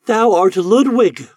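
high, loud and slow, astonished; crying out in recognition. A narrator's reading. Eloise cries out, recognizing Ludwig, high and loud. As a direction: gasp it out as the truth dawns. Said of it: "cried Eloise".

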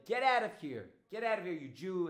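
loud, high angry shout; cursing angrily. Acted. Woman cursing Dodi as she throws her out, angry. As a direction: throw them out with a furious yell. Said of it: "started cursing at her".

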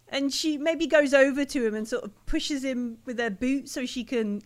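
ordinary talk, nothing marked

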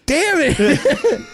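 high, laughing "damn it"; laughing exasperation. Retold or imitated. Brother laughing in exasperation after the fall, high. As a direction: laugh through it, half exasperated. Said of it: "He goes".

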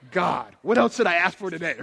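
loud, thrilled cry to God; delighted prayer. Retold or imitated. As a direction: bubble over with joy at answered prayer. Joyful retold prayer.

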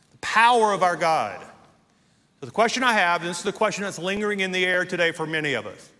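ordinary talk

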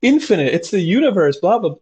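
high, wide-eyed wonder at a leaf; awed tripping patient. Retold or imitated. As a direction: gush like someone seeing the universe in a leaf. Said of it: "take the psilocybin, it's like".

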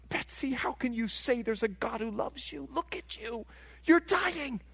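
acted-out inmate questioning a dying friend; incredulous challenge. Inmate doubting the dying Betsy.

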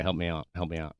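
low, softened sexy voice; bedroom voice. Shown as a demonstration. Host asking his co-host for bedroom-voice coaching, low and soft. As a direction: sink into a quiet, low bedroom murmur. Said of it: "my bedroom voice".

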